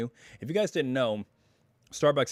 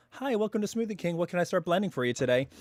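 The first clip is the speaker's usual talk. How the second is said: higher-pitched recorded woman's greeting voice; female drive-thru greeter; 7 semitones higher, faster than usual. Retold or imitated. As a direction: imitate an automated woman taking orders. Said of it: "You have, like, a woman go".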